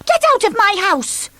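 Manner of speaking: bossy shout. Acted. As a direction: throw him out. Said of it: "shouted Miss Bossy".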